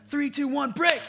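loud, high coach's countdown; huddle break call. Retold or imitated. Pastor imitating a pep-talk coach, a loud high countdown. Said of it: "And then he's like".

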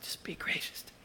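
a broken man's hushed prayer; whispered plea. Retold or imitated. David at rock bottom, whispering to God for grace. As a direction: whisper it, barely breathing the words. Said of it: "he's probably not even screaming".

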